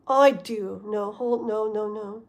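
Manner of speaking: ordinary talk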